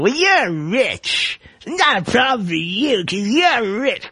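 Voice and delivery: high, drawn-out mocking whine; mimicked sisters. Host imitating sisters saying it's no problem when rich, mocking. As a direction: imitate a sister's complaint with swooping pitch. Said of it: "like my sisters would do".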